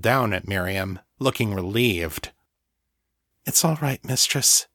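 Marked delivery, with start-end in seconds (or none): 3.5-4.7 lightly voiced thrall, slightly higher; reassuring thrall. Thrall comforting her weakened mistress, mildly reassuring. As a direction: soothe your mistress in a higher voice.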